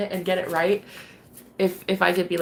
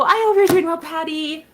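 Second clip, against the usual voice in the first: way up and louder, sing-song; sweet high greeting; 9 semitones higher, 5 dB louder than usual. Real, not acted. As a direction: beam a welcome at Patty.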